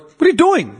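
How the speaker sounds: mock telling-off. Uncle grabbing his nephew, acted scolding. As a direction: pull the kid up short, acted.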